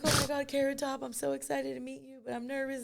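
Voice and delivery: high, soft starstruck gush; mock starstruck. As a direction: gush like a nervous fan. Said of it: "I was like".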